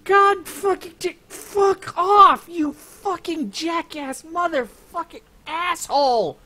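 furious shouted swearing at the game; swearing tirade. Real, not acted. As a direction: explode in a loud cursing rage. Rage at enemy units in game.

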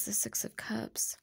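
soft whispered card name; whispering. Real, not acted. Reader whispering the last card she drew to herself. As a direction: murmur it under your breath as you lay it down.

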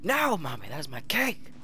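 put-on high whiny toddler; toddler whine. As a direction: whine like a two-year-old. Voices the toddler character.

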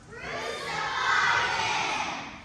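high, slow drawn-out cry of crucify; crowd yell. Retold or imitated. The crowd demanding of Pilate, a high drawn-out cry. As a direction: call it out long and rising like a mob. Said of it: "The people yelled".

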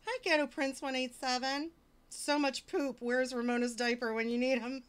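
ordinary talk, nothing marked

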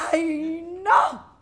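high, excited squeal of congratulations; over-the-top thrilled friend; mock gushing delight. Acted gushing at a supposedly pregnant friend.

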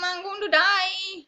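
high, loud, slow cry; doomed wail. Real, not acted. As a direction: draw it out in despair. Doomed in game.

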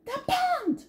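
ordinary delivery

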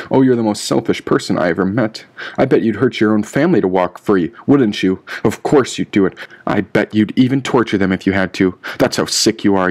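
plain reading voice

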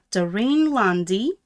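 ordinary delivery, nothing marked

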